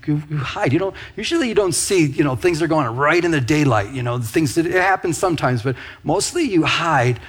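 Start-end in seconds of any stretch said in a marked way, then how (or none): none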